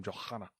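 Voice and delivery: Johanna with a heavy H, said softly; emphasised H. Real, not acted. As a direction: lean hard on the H in Johanna. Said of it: "So much emphasis on the H".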